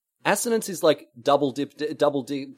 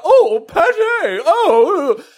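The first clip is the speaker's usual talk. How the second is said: much higher, louder mock teasing; mocking family; 10 dB louder, much slower than usual. Retelling Scottish relatives mocking posh pate, high teasing squeal. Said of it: "they're all like".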